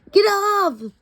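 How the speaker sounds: put-on high, sharp kid's shout; high cranky child yelling. A narrator's reading. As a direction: shout it like a cranky kid on the bus. Kid shouting on the bus.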